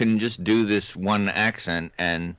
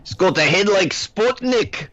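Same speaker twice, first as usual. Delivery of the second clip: mock Scottish dad; 14 semitones higher, slower than usual. Quoting a movie scene.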